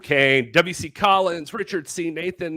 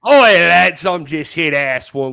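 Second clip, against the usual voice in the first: mock British accent that slips into Australian; a loud, hammy British "Oi, lads"; joking British accent that came out Australian; bad British accent; 2 semitones higher, 7 dB louder than usual. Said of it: "that sounds Australian".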